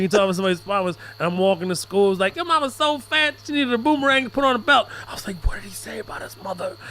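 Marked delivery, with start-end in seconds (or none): 2.4-4.9 put-on high, taunting kid voice; playful kid taunting, pitched up; schoolkid taunt. Schoolkid telling a yo-mama joke. 5.5-6.8 soft, stunned aside; horrified under the breath; quiet mock disbelief; shocked murmur. Shocked reaction to yo-mama joke.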